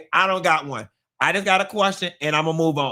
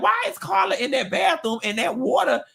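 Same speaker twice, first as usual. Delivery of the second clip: much higher puzzled wondering; high baffled inner voice; 5 semitones higher than usual. Retold or imitated. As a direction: wonder aloud, high and confused. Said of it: "And I was like".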